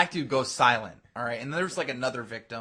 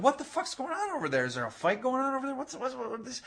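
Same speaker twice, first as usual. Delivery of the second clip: high, rapid-fire imitated questions; mock confused alarm; 11 semitones higher, faster than usual. Players hear shouting over there, mock bewildered alarm. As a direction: fire off startled questions, pitched way up. Players react to commotion, quoted.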